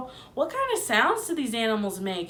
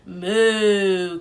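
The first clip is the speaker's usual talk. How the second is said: louder moaning moo; cow moo; 3 semitones lower, 6 dB louder than usual. A narrator's reading. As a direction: moan like a cow. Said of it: "cow moaned".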